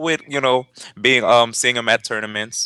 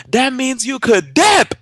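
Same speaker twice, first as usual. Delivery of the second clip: high, loud, drawn-out taunt, much higher pitch; brother's comeback; 14 semitones higher, 4 dB louder, slower than usual. Retold or imitated. Brother taunting the dad, loud high drawn-out taunt. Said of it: "So then my brother goes".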